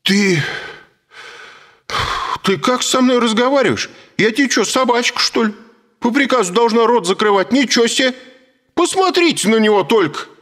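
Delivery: high, loud indignant woman; outraged shrill wife. A narrator's reading. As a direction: blow up in offended outrage. Wife scolding husband. (возмущённый крик жены)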